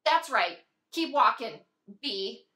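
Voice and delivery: loud, low, scornful; hostile send-off. Acted. Seeing off the betraying friend, hostile. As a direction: taunt them as they go.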